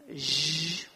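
buzzing English "zh"; zh sound. Shown as a demonstration. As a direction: hold a voiced zh like in measure. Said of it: "en inglés tenemos sonido". (sonido "zh" sonoro del inglés)

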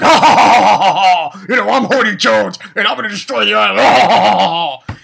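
loud, hammed-up gloating villain; mock evil laugh then a shouted boast. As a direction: cackle and boast like a cartoon bad guy. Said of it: "And then Horty's like".

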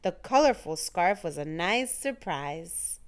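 usual speaking voice

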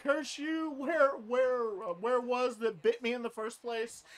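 high, loud hammed-up outrage; mock curse. Imagined werewolf cursing whoever bit him, mock fury. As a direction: shake a fist at the sky.

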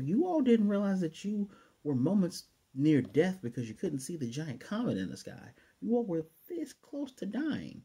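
high, incredulous put-on voice; exasperated inner voice. Vlogger venting at clueless characters, high and incredulous. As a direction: scold the oblivious family in disbelief. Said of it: "I'm thinking to myself".

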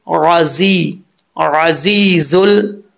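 राजीजुल slowly, throaty ayn and whistling z; whistled zaa. Shown as a demonstration. Teacher answering how to read it, slow whistled z. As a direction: stretch राजी, keeping the z buzzing. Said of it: "सीटी के साथ पढ़ा जाता है".

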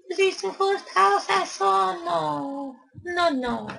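very high, squeaky little voice. Acted. Gamer asking himself about the house, squeaky high voice. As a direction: ask it like a tiny cartoon critter, way up high.

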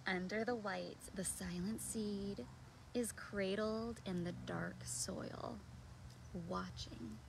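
slow, low, soft storytelling; hushed picture-book reading. Acted. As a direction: let it sink low and quiet, almost to a murmur. Reads picture book aloud.

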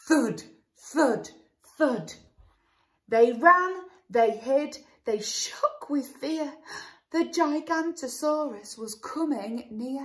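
put-on sing-song read-aloud for children; lilting rhyme for a little listener; storybook narration. A narrator's reading. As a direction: read it like a bedtime picture book.

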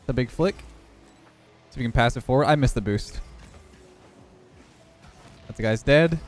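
usual speaking voice

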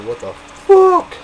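high, loud what-the-heck cry; outraged disbelief. Real, not acted. Finding no habanero on the menu, outraged disbelief. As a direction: throw up your hands in disbelief.

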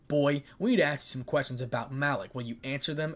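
ordinary delivery

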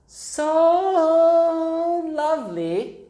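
high, drawn-out swoon; gushing. A narrator's reading. Child gushing about how Miss Jennings smells, high drawn-out delight. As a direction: stretch "so" long and high in admiration.

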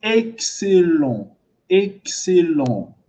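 "Excellent" said very slowly, syllables stretched; careful pronunciation model. Shown as a demonstration. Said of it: "C'est comme ça qu'il faut prononcer". (« Excellent » prononcé très lentement, syllabes allongées)

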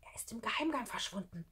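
excited secret whisper; hushed, breath-only voice. Acted. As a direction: whisper the discovery to a friend. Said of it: "flüsterte Schlunz".

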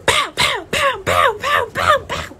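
high, loud rapid-fire pows; imitated smacking blows. Storyteller acting out the hand whooping, loud high pows. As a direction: punch out each whack sound.

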